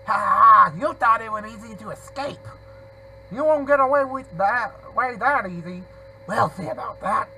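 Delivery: loud villain laugh into a taunt; gloating villain. Acted. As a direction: gloat like a game villain who caught the escapee. Reads out a game villain's taunt.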